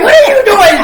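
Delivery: high, loud shocked shout; shocked yelling. Retold or imitated. Sister yelling at her brother over the gun, shocked and loud. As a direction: yell at him in disbelief, half laughing.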